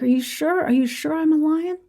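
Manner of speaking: high, unsure questioning; the cub asking the lion if it is really true; doubtful little cub. Acted. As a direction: ask it like a timid cub who can't believe it. Cub doubts his reflection.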